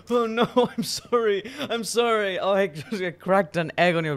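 flustered apology. Real, not acted. Cracked egg on friend's head.

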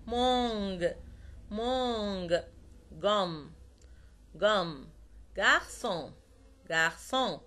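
مونج، جوم، جارسون each with a hard g. Shown as a demonstration. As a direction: sound the ج in جارسون like g in "go". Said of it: "بدون تعطيش".

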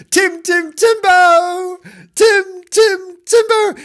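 very high, loud sing-out call. Acted. Two children call the hiding cat, loudly. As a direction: holler the pet's name in a high voice. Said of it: "The two children called him loudly".

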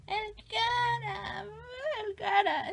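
high, drawn-out squeal of delight over the name; real excitement rising to a squeal; excited squeal. Real, not acted. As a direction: gush over it like a thrilled fan. Excited at finding old drawing.

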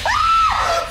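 high terrified shriek; scream. Real, not acted. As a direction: shriek in fright. Jump scare.

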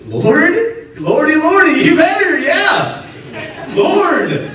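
Lord? cried out high and frantic; panicked pleading. Retold or imitated. Paul panicking at the voice.

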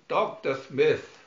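loud scolding call; chiding. Acted. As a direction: scold him sharply. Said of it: "chided the robot".

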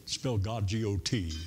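"God" said slow with a hard final T; religious G-O-T. Shown as a demonstration. Preacher mocking a churchy over-pronounced God. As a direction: stretch "God" and snap the T at the end. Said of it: "with emphasis on the T".

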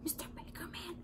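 urgent hushed whisper; frantic whisper. A narrator's reading. Donut secretly phones baker, frantic whisper. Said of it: "Arnie frantically whispered".

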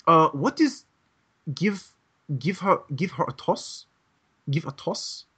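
put-on halting, questioning foreign-learner accent; imitated learner puzzling out a phrase in accented English; English learner's accent. Coworker asking about a movie phrase, halting learner's accent. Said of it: "coworker who was, uh, trying to learn".

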